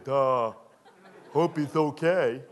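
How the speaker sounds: dopey friend. Acted. Friend glancing out the window.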